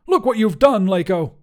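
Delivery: high, loud scolding; accusing shout. A narrator's reading. Wizard blames his companion, shouting high. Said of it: "Grandois shouted".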